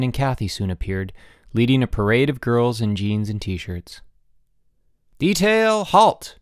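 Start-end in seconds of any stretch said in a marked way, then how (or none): 5.2-6.4 high, loud drill-style command; barked order. A narrator's reading. Diane halting her parade of girls, barked order. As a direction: call the work detail to a halt. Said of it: "ordered Diane".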